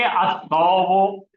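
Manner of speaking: अत्तौबु stretched slowly with a rise at the end; slow Arabic reading. Shown as a demonstration. Teacher answering his own question, slowly modelling अत्तौबु. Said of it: "तो क्या बन गया अल को मिलके?".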